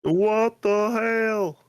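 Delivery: high, slow drawn-out outrage; exaggerated outrage. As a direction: stretch it out, high and indignant. Mock outrage at Amazon-only.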